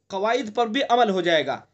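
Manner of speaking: ordinary delivery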